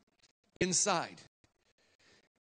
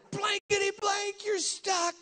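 high, slow, strained stand-in curse; mock shout; 13 semitones higher, slower than usual. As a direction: squeal it at him. Partier yells at stuck preacher.

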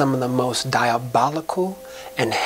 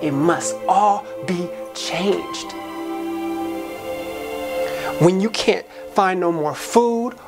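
higher-pitched, impassioned spoken-word climax; rousing exhortation; 4 semitones higher than usual. Acted. Charging listeners to tear down the walls, rousing and high-pitched. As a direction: build it like a preacher's charge, pitch climbing.

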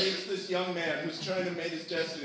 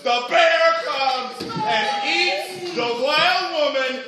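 loud, slow dramatic narration, pitched up; dramatic climax; 6 semitones higher, 6 dB louder, much slower than usual. Acted. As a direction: draw out the big bear attack. Narrator announces the bear attack climax.

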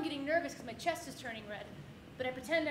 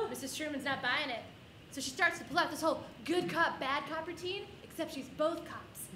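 ordinary talk, nothing marked

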